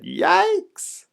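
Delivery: very high, loud shriek of alarm; comic high-pitched fright; startled yelp. A narrator's reading. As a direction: yelp as if shocked. Father startled by full cart.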